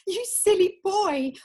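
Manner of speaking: put-on giggling tease at a silly boy; laughing fondly. Laughing at the proposal.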